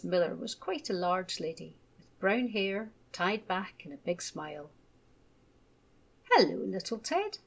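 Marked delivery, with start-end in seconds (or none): 6.3-7.4 bright, loud and high welcome; beaming teacher greets a shy new boy. A narrator's reading. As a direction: greet him with a big beaming smile. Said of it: "she beamed".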